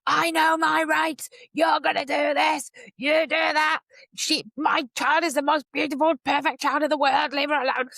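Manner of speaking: loud, high, forceful demands; demanding parent. Retold or imitated. To local authority staff, loud pushy demands. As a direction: insist on your rights loudly. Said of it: "kinda going".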